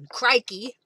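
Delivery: a hint of Australian wildlife-host accent; Steve Irwin. Crafter recalling a TV favorite, mild Australian impression. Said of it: "my days of listening to Steve Irwin".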